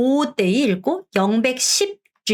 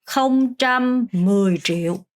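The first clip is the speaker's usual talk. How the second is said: very slow teacher's reading; drawn-out number demo; 2 semitones higher, much slower than usual. Shown as a demonstration. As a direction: stretch each syllable for the class. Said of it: "그 다음에 영백".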